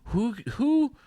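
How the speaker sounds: high, rising disbelief; mock bewildered; incredulous squeal. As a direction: react in baffled outrage. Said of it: "I'm like".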